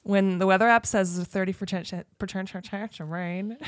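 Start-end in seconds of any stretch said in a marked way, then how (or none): 1.9-3.6 tripping over "chance"; stumbling. Real, not acted. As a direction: stutter through "chan-, chance of rain". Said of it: "say it clearly".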